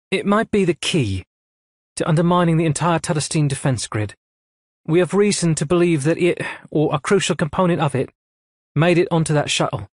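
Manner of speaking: brisk officer delivering orders; a hint of a cool female commander; lightly voiced admiral. A narrator's reading. Admiral briefing.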